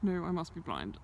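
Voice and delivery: ordinary talk, nothing marked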